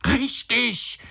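very high, sudden exclamation; cried out. A narrator's reading. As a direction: burst out in excited agreement. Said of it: "schrie der Onkel". (hoher, aufgeregter Aufschrei)

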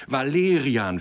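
loud, high jubilant cry; joining the cheer. A narrator's reading. Others joining the leader's cheer, exultant. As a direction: take up the name loudly. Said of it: "fielen die anderen ein".

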